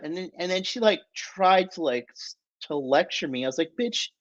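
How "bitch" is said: Bitch, jumping up high; mock retort. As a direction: fling Bitch up high in disbelief. Retorting to the girl's lecture.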